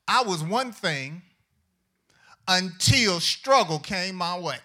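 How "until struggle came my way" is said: ordinary delivery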